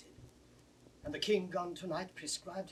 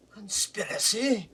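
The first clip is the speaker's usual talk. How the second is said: shocked cry; 6 semitones higher, 9 dB louder than usual. Acted. Gloucester shocked by letter.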